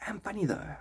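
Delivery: soft unvoiced whisper; hushed insistence. Real, not acted. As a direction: whisper it as a secret. Whispering to herself.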